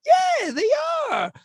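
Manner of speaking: high shouted cheer, like a crowd agreeing; a stadium crowd roaring its agreement. Retold or imitated. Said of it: "and they went".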